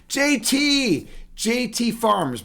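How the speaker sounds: high, drawn-out happy call of a name; excited greeting. Real, not acted. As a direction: hail an arriving regular, stretched and high. Streamer excitedly greets arriving chat regular.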